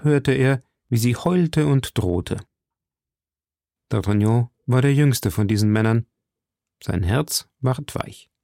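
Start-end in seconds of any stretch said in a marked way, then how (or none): none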